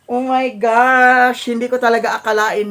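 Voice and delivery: excited. Real, not acted. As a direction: stretch it out, thrilled. Opening long-awaited silver button.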